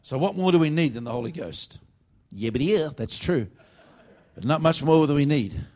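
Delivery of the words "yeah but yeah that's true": light cartoon stutter; hint of a stuttering cartoon character; Porky Pig. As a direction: briefly slip into a stammering cartoon pig. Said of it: "I feel a Porky Pig anointing coming on".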